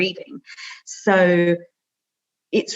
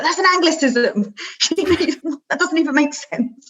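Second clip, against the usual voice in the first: high-pitched, laughing imitation of a co-writer objecting; teasing mock complaint with a laugh; mock objection; 8 semitones higher than usual. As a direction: playfully protest in a raised pitch.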